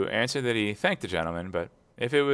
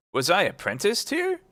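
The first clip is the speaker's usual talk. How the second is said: sudden high amazed question; surprised question; 10 semitones higher than usual. A narrator's reading. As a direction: rise up in disbelief. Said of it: "asked Scrooge if he knew it".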